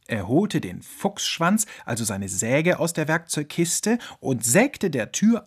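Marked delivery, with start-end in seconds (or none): none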